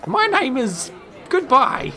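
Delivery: put-on high, slow, tart old woman. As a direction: drag it out high as a dismissive old lady. Old woman retorts to Plague.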